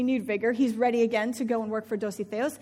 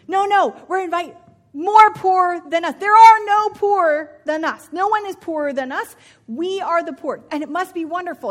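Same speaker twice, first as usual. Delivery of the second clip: breathless, louder and higher with joy; excited husband; 4 semitones higher, 9 dB louder than usual. Acted. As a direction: gush like a man who can't contain good news. Husband gushing about banquet plan.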